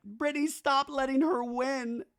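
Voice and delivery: high-pitched whiny imitation; mock pleading. Recapper imitating Zach urging Brittany, high mock whine.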